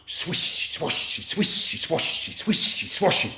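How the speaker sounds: whispery, rhythmic swishes; soft, hissing sound effect; swishing grass noise. Acted. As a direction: make the grass rustle with each word. Imitates walking through long grass.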